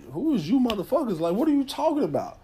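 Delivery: high, indignant retort; indignant disbelief. Retold or imitated. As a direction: throw it back at him, offended. Retold heated exchange with officer.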